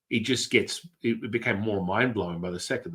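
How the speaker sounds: ordinary talk, nothing marked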